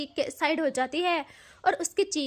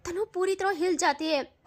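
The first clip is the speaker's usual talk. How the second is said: ordinary delivery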